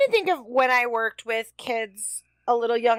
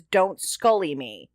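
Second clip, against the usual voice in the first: Don't Scully Me said slow and deliberate; slow hashtag delivery; 7 semitones lower, slower than usual. Retold or imitated. Reviewer stating her hashtag to the panel, slow and deliberate. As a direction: spell out "Don't Scully Me" word by word. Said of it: "my permanent hashtag for this is".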